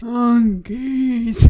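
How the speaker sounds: loud delirious moaning cry; Peeta, half-paralysed, moans the word loudly. Retold or imitated. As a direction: groan it out, still dazed. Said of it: "kind of moaning".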